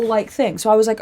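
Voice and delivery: ordinary talk, nothing marked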